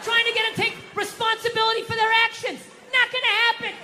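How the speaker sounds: high, worked-up exasperation; exasperated rant. Acted. Husband venting on pleasing a wife, high exasperation. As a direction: vent at a high pitch about a lost cause.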